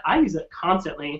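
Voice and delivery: ordinary delivery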